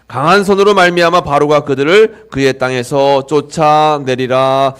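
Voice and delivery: 크고 느리게 한 자 한 자 읽는 봉독; 회중 앞에서 장엄하게 말씀을 선포하듯. Acted. (설교자가 회중과 함께 크고 느리게 읽는 성경 봉독)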